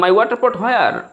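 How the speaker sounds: "My water pot where?" pitched high as a learner's eager broken English; broken English try. Shown as a demonstration. Teacher imitating a student's broken English, pitched high. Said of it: "তো আপনি বলেন".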